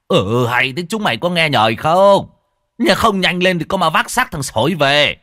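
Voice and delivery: high, loud scolding yell; angry shout. A narrator's reading. Old Tân to the hesitating men, shouting angrily. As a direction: bellow at the dawdlers to get moving. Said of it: "lão Tân quát".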